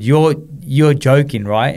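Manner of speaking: can't take in the good news; disbelieving. Retold or imitated. Asking the doctor if he's serious, slow stunned disbelief.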